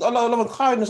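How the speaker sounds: high mocking tone with a laugh; mocking quote. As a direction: laugh while parroting it high. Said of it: "Bro, you think".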